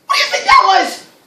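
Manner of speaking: very high-pitched, panicky squeal; mock fright. Teacher asks class about the roar, mock fright. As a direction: squeal it as if terrified.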